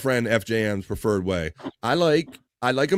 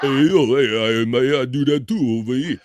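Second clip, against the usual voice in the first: mock tough guy; 4 dB louder than usual. Reading listener comment in voice.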